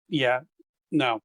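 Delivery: ordinary delivery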